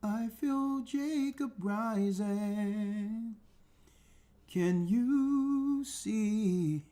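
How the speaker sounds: drawn-out, slow preacher's chant; slow prophetic intoning. Acted. Preacher intoning a vision to his congregation, drawn out slow.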